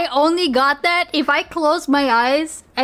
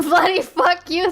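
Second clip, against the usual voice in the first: laughing repeat; 5 semitones higher than usual. Retold or imitated. Viewer repeating the clip's swearing, laughing aloud. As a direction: say "bloody fuck you" aloud through a laugh.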